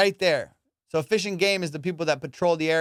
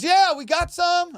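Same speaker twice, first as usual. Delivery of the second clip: clueless buddy; 13 semitones higher, 5 dB louder, slower than usual. Retold or imitated. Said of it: "And he goes".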